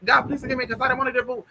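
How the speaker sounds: high, rushed confession; imitated fast babbling. Dying man confessing to God, imitated fast babble. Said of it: "like, speaking fast shit".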